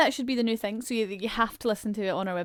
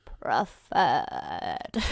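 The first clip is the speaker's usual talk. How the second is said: put-on much lower, very old wizard voice; old Dumbledore; 9 semitones lower than usual. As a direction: croak it like an ancient wizard. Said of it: "the old one would be like".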